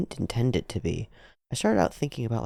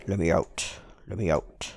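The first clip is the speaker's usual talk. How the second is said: lower, deliberate plea; low-voiced captive; 5 semitones lower than usual.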